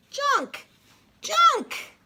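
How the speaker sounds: high, strained little grunts of effort; straining squeak. Acted. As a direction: squeak like a tiny digger struggling to lift. Excavator strains to lift.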